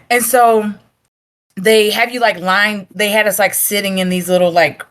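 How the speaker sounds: ordinary delivery, nothing marked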